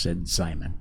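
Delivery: usual speaking voice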